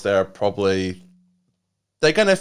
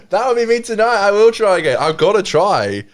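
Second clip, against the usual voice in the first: fired up; 8 semitones higher, 5 dB louder than usual. Real, not acted. Vows to try again, pumped.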